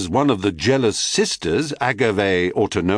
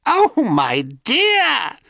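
high, slow, louder crone screech; 4 dB louder, much slower than usual. A narrator's reading. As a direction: shriek it like a crone. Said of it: "screeched the crone".

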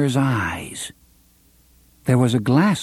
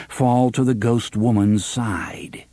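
plain reading voice

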